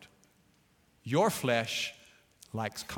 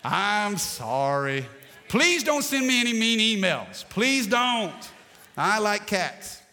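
playful pleading, pitched up; mock apology; 4 semitones higher, 3 dB louder, slower than usual. Real, not acted. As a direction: beg them, laughing it off. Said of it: "I'm teasing".

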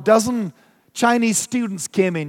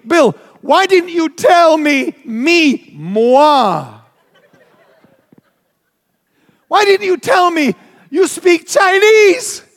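high, loud, slow accusing outburst; building to a high indignant shout; upset shocked yell; 8 semitones higher, 6 dB louder, slower than usual. Retold or imitated. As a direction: yell at a friend who hid a secret. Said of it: "I said".

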